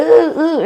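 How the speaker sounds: high loud ooh ooh of pain; hammed-up stomach pain cries; mock pained moans.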